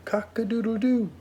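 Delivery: high crowing rooster. Pretend. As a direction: crow like a rooster.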